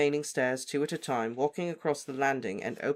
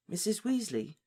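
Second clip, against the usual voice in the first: croaked; 7 semitones higher than usual. Acted. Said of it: "Harry croaked".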